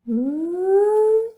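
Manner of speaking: high, loud teasing ooh; sing-song teasing ooh. A narrator's reading. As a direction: tease like a schoolgirl. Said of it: "teased Marcia".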